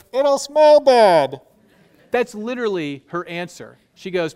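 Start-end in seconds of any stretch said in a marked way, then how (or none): 0.1-1.4 high, loud, slow comic whine; much higher and louder, drawn out like a sketch character; Monty Python Martha. Retold or imitated. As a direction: ham up a squeaky Monty Python woman. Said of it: "Martha goes".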